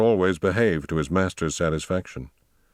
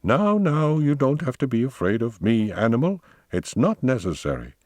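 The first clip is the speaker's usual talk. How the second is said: higher-pitched, talking down to a frightened animal; condescending reassurance, pitched up; patronizing; 6 semitones higher than usual. A narrator's reading. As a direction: soothe it as if it were beneath you. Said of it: "said patronizingly".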